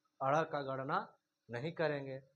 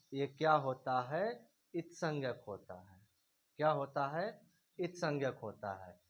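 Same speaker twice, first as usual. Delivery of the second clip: ordinary delivery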